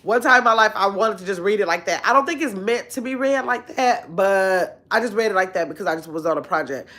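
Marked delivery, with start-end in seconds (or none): none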